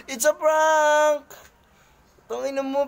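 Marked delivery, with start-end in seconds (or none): 0.1-1.2 drawn-out, higher call; slow, stretched announcement of a prank; prank reveal shout. Real, not acted. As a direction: call it out long and high.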